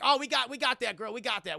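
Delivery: loud, high-pitched eager outburst; eager agreement. Retold or imitated. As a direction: jump in all keen, imitating an excited helper. Retold eager detectives taking the case.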